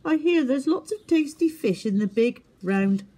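sly owl voice; sly brother. A narrator's reading. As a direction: lure him into the trick. Bump tricks Twit.

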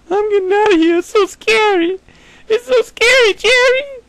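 loud, very high comic squeal; mock panic. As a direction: squeal in hammed-up fright. Mock panic in dark tunnel.

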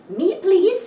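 high, loud little cat voice; eager cat. Acted. Cat begging the horse for a ride, high and eager. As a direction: squeak it out eagerly. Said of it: "says Cat".

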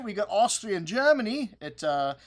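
mock German accent, hammed-up and slowing at the end; bad German accent. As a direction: put on a clumsy German accent for the restaurant name. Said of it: "That was a horrible accent".

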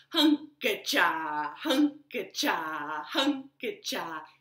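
slow rhythmic chop sound; sawing chant. Shown as a demonstration. Storyteller makes the woodcutting sound for children, slow. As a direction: chant each hunka-cha slowly like chopping. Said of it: "Let's try it all together".

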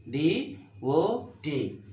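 D, O, T as three separate beats; syllable by syllable. Shown as a demonstration. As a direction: pause after each part of "D O T". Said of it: "Three syllables are there".

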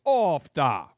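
drawn-out Minnesotan exclamation; Minnesota accent. Retold or imitated. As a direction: imitate a Minnesotan, long open vowels. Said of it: "bad will happen, it's like".

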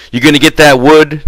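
impatient demand. A narrator's reading. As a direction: push the question out like an order. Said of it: "George demanded".

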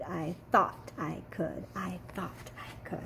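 fading to an unvoiced whisper; teacher fading the kids' chant to a whisper. Shown as a demonstration. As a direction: let it die away to a whisper. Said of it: "Can you say that with me?".

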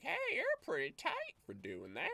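high, squawky character voice; high-pitched bird talk. Acted. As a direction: voice him like a cartoon bird. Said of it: "I gave him a bird voice".

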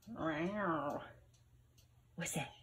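ordinary delivery

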